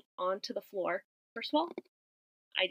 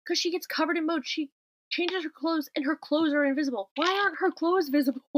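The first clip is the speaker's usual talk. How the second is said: higher, excited disbelief; incredulous note; 5 semitones higher, 3 dB louder than usual. Retold or imitated. Podcaster reads her puzzled movie note to co-host, high excited disbelief. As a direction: rattle it off, baffled and keyed up.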